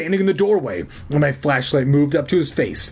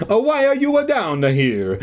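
high-pitched stage-Italian demand; hoarse Italian accent; 10 semitones higher than usual. A narrator's reading. Janitor confronting intruders in the basement, hammed-up Italian accent. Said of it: "demanded in his hoarse Italian voice".

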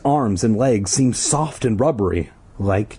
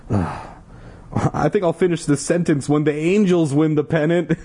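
joking voice; 6 semitones higher than usual. Pretend. Joking aside in put-on voice.

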